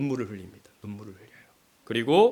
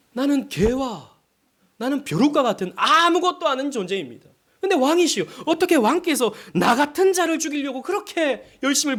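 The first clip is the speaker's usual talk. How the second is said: higher, louder humble plea to the king; David pleading; 9 semitones higher, 3 dB louder than usual. Retold or imitated. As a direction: voice David begging Saul, raised and earnest.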